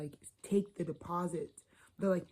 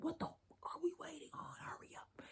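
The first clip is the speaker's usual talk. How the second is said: high, soft whisper; whispered impatience; 10 semitones higher, 10 dB quieter than usual. Retold or imitated. Reader voicing Cancer urging hurry, high whisper. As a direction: hiss it urgently under the breath. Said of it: "you're so like".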